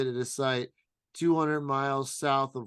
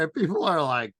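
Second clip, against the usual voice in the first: ordinary talk, nothing marked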